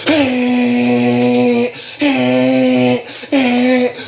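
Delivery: long, high held honking note; imitated car horn. Old lawyer type pulls up honking at him, imitated honk. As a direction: mimic someone leaning on the horn.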